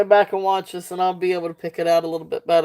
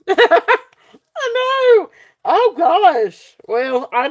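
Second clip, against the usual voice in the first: high, loud laughing words; 10 semitones higher, 5 dB louder, much slower than usual. Real, not acted. Seller laughing at her own accent. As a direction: burst out laughing.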